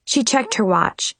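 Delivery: ordinary delivery, nothing marked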